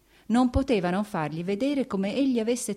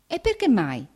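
astonished question, higher and louder; quasi stupore; 6 semitones higher, 5 dB louder than usual. A narrator's reading. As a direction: ask it in raised-pitch disbelief. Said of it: "disse Vronsky quasi con stupore". (quasi con stupore)

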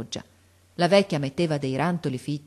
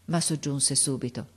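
plain reading voice

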